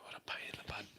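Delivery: hushed annoyed whisper. Real, not acted. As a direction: mutter it under the breath. Frustrated aside to self.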